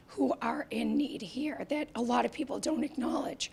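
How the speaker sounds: whispering as the voice fails; voice dropping to a whisper. Real, not acted. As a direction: let the voice fade to breath. Voice gives out mid-sentence.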